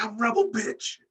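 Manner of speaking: high-pitched mock boast; Rebel. Imagining a wrestler turning on her partner, mock brash reveal. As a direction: announce it high and sassy, hammed-up. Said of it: "She's gonna be like".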